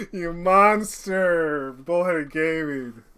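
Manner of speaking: loud, slow, drawn-out reproach; big, lingering cry of blame; mock accusation. Real, not acted. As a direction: stretch it out loudly in full voice. Same reproach, now full voice.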